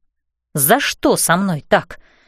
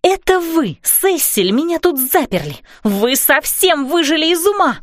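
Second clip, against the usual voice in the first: angry shout; 8 semitones higher than usual. A narrator's reading. Furious heroine accuses them.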